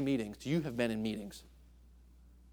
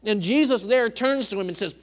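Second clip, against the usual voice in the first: loud, high preacher's fervour; preaching; 9 semitones higher, 10 dB louder than usual. Acted. Preacher driving the point home to church family, loud and high.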